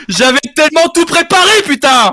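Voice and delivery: high, loud cry of frustration; hammed-up despairing shout; exasperated outburst. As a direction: wail it out at full volume. Meme sound fails, mock outburst.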